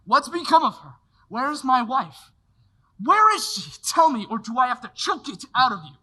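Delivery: loud, rising, frantic questioning; desperate demand. Acted. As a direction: force the answer out of him. George demands to know about wife.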